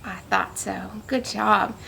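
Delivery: ordinary delivery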